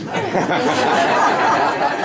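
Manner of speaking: a burst of real laughter at his own punchline; loud laugh. Real, not acted. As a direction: laugh out loud through the last word.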